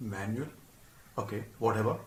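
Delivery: low, soft resigned mumble; deflated mumble. Retold or imitated. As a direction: give up under your breath. Cadet deflated by the brush-off.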